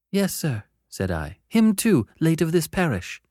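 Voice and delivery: put-on high, frightened boy; timid child. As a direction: explain timidly.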